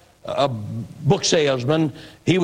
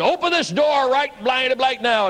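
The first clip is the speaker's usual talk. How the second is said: hammed-up drunken demand in a raised pitch; retold shout; 9 semitones higher than usual. Drunk dad at the locked door, mild mock yell. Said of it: "Dad shouting out there".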